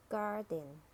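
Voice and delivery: "garden" with a sounded American R; American "garden", curl the R before d; American accent. Shown as a demonstration. Teacher models garden for learners, American accent. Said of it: "R in American English".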